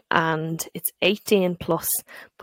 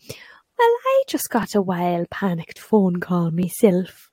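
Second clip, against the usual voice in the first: put-on slow, loud Donegal accent sliding into Jamaican; badly faked Donegal accent, drawn out; botched Donegal accent; 5 semitones higher, 8 dB louder, much slower than usual. Solicitor from Donegal passing on the panic, badly imitated Donegal accent. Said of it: "Why is he Jamaican?".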